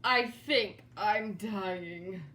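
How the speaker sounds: slow, pained groan of a dying man; groaning slowly. Acted. George moaning to himself, holding his aching jaw. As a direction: groan it out through a sore jaw. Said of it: "he held his jaw and groaned".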